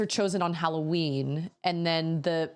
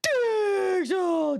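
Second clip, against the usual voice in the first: put-on high, loud child's yell of Diesel; toddler shout; 14 semitones higher, 5 dB louder than usual. Mother imitating her toddler yelling the dog's name. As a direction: shout it like a toddler roaming the house. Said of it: "just being like".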